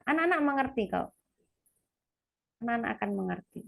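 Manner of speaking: normal talking voice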